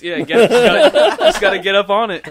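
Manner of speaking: high and loud, words riding on laughter; real laughing. Real, not acted. Friend joking about games, laughing as he talks. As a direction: crack up while getting it out.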